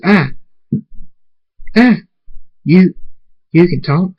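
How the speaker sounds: yelp, then ask in disbelief; startled. A narrator's reading. Zara is startled by a talking tree, astonished.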